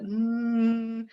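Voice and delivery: sustained, even hum; humming demo. Shown as a demonstration. Coach showing the buzz at the front, steady humming. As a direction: hold a buzzy closed-lip hum. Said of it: "to do this is to hum".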